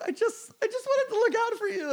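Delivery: very high, squeaky imitation of crying; mock bawling. Retelling a tearful explanation to Josh, mock bawling. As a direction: act out tearfully pleading in a tiny high voice. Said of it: "bawled my fucking eyes out".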